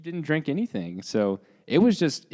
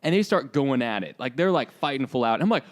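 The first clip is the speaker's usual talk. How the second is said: usual speaking voice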